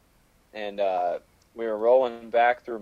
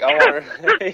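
imitating a guy; 13 semitones higher, slower than usual. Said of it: "he's like".

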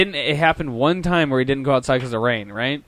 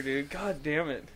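ordinary talk

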